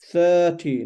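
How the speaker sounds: THIRTY with a long, loud first syllable; stretched vowel. Shown as a demonstration. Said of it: "it's stretched".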